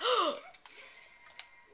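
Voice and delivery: loud crowd-style gasp, hammed up; shocked gasp. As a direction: gasp in mock horror. Everyone at party shocked.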